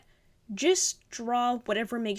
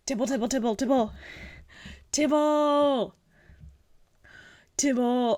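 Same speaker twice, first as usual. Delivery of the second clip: gasp, then call the name louder and louder; excited gushing; 4 dB louder than usual. Real, not acted. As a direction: squeal the name with delight. Gasping at seeing a cute drawing.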